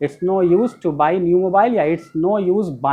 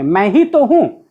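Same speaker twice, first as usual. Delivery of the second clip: ही stressed, louder and slow; emphatic stress; 3 semitones higher, 5 dB louder, slower than usual. Shown as a demonstration. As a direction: point at yourself, press ही. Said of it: "अपने आप पर जोर डाल करके बोला". ("ही" पर ज़ोर डालकर, ख़ुद की ओर इशारा करते हुए)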